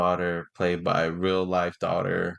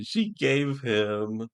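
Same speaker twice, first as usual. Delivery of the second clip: usual speaking voice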